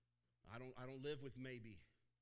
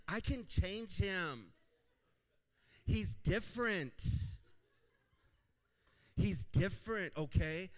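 slow, high, loud mock voice; hammed-up lovestruck voice; mock hopeful girlfriend; 6 semitones higher, 12 dB louder, slower than usual. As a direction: play the girl sure she can fix him.

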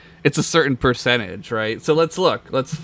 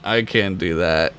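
ordinary delivery

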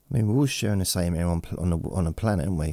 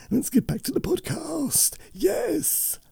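very high squeaky voice, cartoonish; squeaky silly voice; slower than usual. Back to the podcast in a very high squeak. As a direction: pitch way up into a squeak as a joke.

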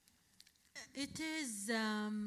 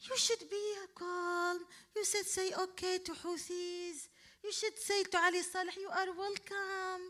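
mocking dictators; 6 semitones higher than usual. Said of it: "they said".

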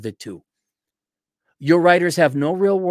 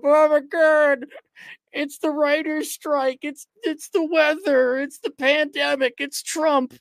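high, slow mock panic; mocking whiny voice; 11 semitones higher, slower than usual. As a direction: whine the excuses in a high mock voice. Mocking executives blaming excuses.

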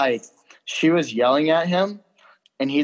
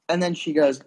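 usual speaking voice

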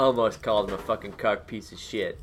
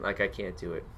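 normal talking voice

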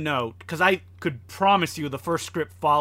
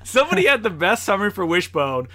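ordinary talk

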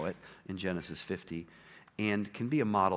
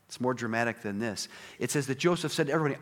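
ordinary delivery

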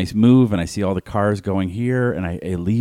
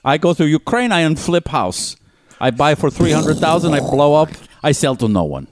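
high mock Russian accent, laboured phrases; Russian-accented house-show host, put on; 7 semitones higher, 3 dB louder than usual. Podcaster as Putin hosting a house show, Russian accent. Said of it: "this H G show where he".

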